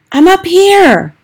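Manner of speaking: high, loud, slow call down from the tree; boy in the tree shouts down to his grandmother; shouted. A narrator's reading. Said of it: "I shouted back".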